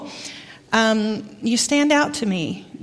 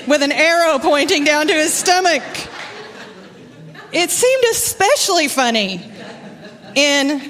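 laughing; 5 semitones higher than usual. Real, not acted. Laughing at funny shirt story.

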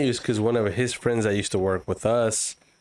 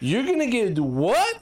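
high, indignant mock mom; 10 semitones higher than usual. Mom hearing he wants a tattoo, high indignant mock scold. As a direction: play the scandalised mother, pitched up.

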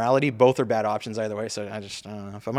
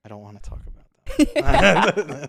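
evasive; 6 semitones higher, 6 dB louder, much slower than usual. Real, not acted. Dodging the loan debt question.

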